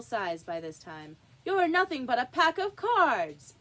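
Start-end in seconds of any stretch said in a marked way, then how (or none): 1.5-3.5 loud, high scornful shout; defiant shout. A narrator's reading. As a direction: shout it in their faces. Alice defies the queen.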